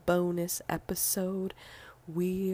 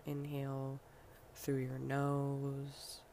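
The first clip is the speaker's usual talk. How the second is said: guided breathing meditation; 6 dB quieter than usual. Acted. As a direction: lead each breath softly, leaving room to breathe.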